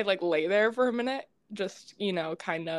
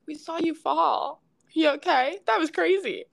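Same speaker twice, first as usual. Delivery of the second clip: concerned strangers; 8 semitones higher than usual. Retold or imitated. Strangers asking if she's okay.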